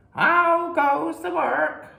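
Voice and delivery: high, slow, loud lazy farmer call. Acted. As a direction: call down from bed, drawn out. Farmer calls again.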